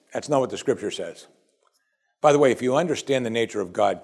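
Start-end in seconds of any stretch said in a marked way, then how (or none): none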